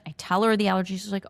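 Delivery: ordinary delivery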